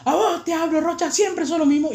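high, indignant teacher imitation; scolding teacher. Teacher to Rocha for talking in class, high scolding.

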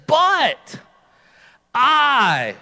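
loud, separated words for "but" and "I"; forceful preacher's declaration; emphatic shout. Acted. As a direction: punch each word, pausing between them. God's emphatic answer to doubting Gideon.

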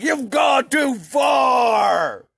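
slow, high, shouted protest; mock outrage. America defying the British, mock shout. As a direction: bellow it like a rebel. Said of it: "America was like".

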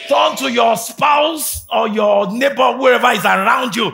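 very loud, high, slow shouted praise; shouting. Real, not acted. Preacher to congregation, shouting praise at the top of his lungs. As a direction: roar it over the crowd. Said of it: "Come on, shout it".

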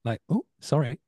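high, small "ooh, sorry"; little mock apology. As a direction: imitate an actress owning up to a mishap. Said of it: "Just a little, like".

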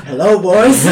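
mock greeting. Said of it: "Scared of".

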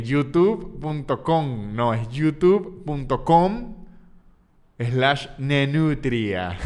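youtube punto com spelled out very slowly; slow careful spelling. Shown as a demonstration. Comedian slowly spelling out his channel address. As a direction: dictate the address word by word. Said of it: "y que ahí lo dije punto com con N".

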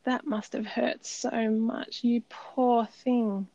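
put-on a mother cradling her crying four-year-old; tender comfort. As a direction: coo it gently, drawing each word out. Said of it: "popping her up on my lap and saying".

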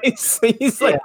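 laughing through the words, slowed down; can't get it out for laughing; real giggle breaking into the lead-in. Real, not acted. Laughing while retelling scene.

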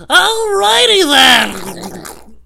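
hugely drawn-out high, loud catchphrase; hammed-up slow shout; Jim Carrey. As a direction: mimic a rubber-faced comedy star, stretching every word. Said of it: "Jim Carrey from Ace Ventura".

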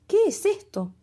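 high, bright curious question. Acted. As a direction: ask it wide-eyed, curious. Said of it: "preguntó con curiosidad".

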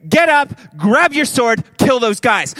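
high, loud orders to fighters; rally them with a loud order; commanding call. Acted. Elijah voiced ordering people.

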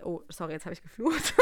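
ordinary talk, nothing marked

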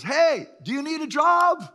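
high, loud call across a park; yelling from a car. Retold or imitated. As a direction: shout it out the car window. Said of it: "someone drives up and yells".

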